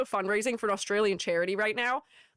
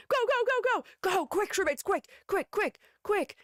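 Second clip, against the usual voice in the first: high, rapid-fire urging; playfully frantic, high-pitched prodding; urgent hurrying; 10 semitones higher than usual. Pretend. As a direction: rush them along in little high bursts. Hurrying viewers before the clock.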